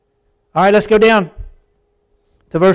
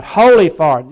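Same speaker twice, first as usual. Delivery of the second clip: loud, impassioned cry to God; preacher voicing a fervent cry; 10 dB louder than usual. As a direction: call out reverently with passion. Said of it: "he cries out".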